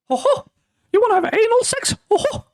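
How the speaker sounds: very high squeaky cartoon mouse voice; cartoon mascot impression, pitched sky-high; Mickey Mouse. Shown as a demonstration. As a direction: squeak it way up in falsetto. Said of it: "able to do Mickey Mouse impressions".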